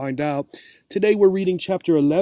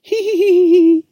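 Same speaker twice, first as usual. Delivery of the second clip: very high, loud, shrill cat giggle; 9 dB louder than usual. Acted. The cat giggling shrilly after Obie touches her paw. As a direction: cackle in uncontrollable high-pitched glee. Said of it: "shrill, uncontrollable, high-pitched giggles".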